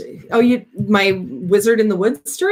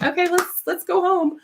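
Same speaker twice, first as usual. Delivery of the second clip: much higher, defeated call to leave; giving up; 10 semitones higher than usual. Retold or imitated. Dog trembling in the snow, higher and giving up. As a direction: throw in the towel. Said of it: "it's just like".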